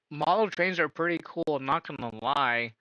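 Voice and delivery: ordinary talk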